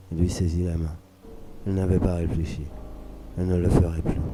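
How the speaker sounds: ordinary delivery, nothing marked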